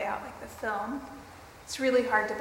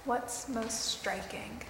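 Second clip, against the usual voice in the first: guided meditation; 3 dB quieter than usual. Acted.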